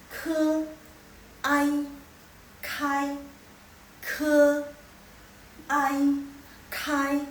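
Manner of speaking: "Ke, ai, kai" one by one, each held level; "Ke, ai, kai" as isolated, sustained model syllables; syllable drill. Shown as a demonstration. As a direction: sound out "Ke", "ai", "kai" separately, loud and high.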